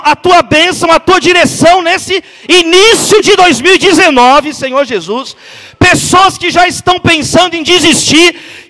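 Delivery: loud, fervent prayer at full force; preaching prayer. Acted. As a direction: cry out to God over the crowd. Leads a loud congregational prayer.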